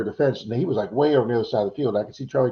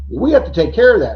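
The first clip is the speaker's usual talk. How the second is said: higher and louder, put-out objection; indignant homeowner; 8 semitones higher, 8 dB louder than usual. Retold or imitated. Florida homeowner objects to the digger, indignant and louder. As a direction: protest like it's your yard.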